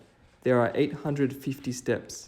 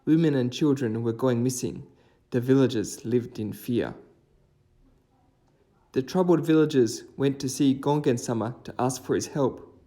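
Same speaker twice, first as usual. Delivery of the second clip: steady, louder storytelling voice; story narration; 6 dB louder than usual. A narrator's reading. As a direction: tell the old legend to viewers. Narrates a folk tale over music.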